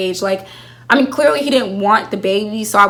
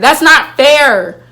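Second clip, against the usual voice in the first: louder and slow, leaning on not; loud slow complaint; 4 semitones higher, 7 dB louder, much slower than usual. Real, not acted. Fan to the writers, slow loud complaint.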